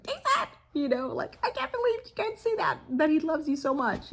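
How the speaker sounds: high, pleading exasperation at her son; exasperated scolding. Retold or imitated. Mother scolding her son for not seeing his brother's love, exasperated and high. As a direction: scold him in frustrated disbelief. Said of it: "I was so mad".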